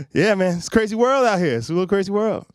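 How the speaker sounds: ordinary talk, nothing marked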